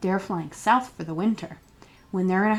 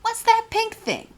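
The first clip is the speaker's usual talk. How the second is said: put-on high, wondering child's question; curious child; 14 semitones higher than usual. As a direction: ask like a puzzled little kid. Curious question about turkey wattle.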